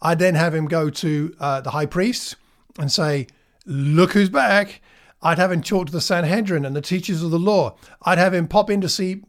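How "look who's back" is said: drawn-out, higher mock taunt; playful gloating. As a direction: crow it slowly, as a joking comeback. Imagined Jesus gloating to high priests.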